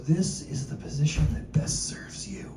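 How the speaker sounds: soft whispered instructor voice; hushed yoga instructor murmur. Retold or imitated. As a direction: breathe it like a calm yoga teacher. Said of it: "he goes".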